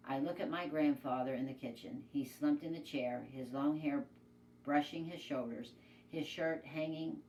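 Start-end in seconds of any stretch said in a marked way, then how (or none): none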